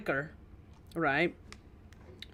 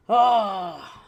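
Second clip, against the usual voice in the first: a much louder hammed-up witch cry; mock spooky yell; 4 semitones higher, 11 dB louder than usual. [name] bursting in on chat, hammed-up yell. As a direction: burst in with a theatrical spooky shout.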